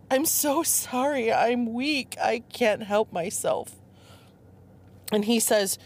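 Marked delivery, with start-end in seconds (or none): 0.1-3.8 mock crestfallen apology, lightly pitched up; a hammed-up guilty confession. Caught wife apologizes to him, mildly crestfallen and slow. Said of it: "looks crestfallen and says".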